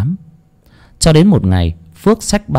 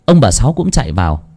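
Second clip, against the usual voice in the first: plain reading voice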